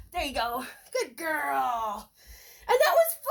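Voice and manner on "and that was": high, loud, gushing praise for the puppy; excited praise. Real, not acted. Trainer gushing to her puppy, excited praise. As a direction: cheer the puppy on.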